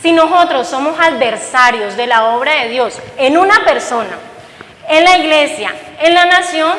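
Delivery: normal talking voice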